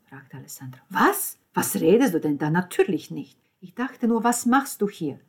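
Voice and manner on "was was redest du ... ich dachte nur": loud, indignant outburst. Acted. Lucia rebuffs Alessandro's question about Parkinson, indignant. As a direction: snap back in loud disbelief. Startled, indignant exchange at the door.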